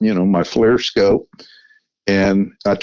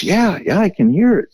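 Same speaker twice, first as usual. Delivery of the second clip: quoted friend's eager higher voice; friend voiced higher; 10 semitones higher than usual. To Matt, friend hears movement on the flank, voiced higher.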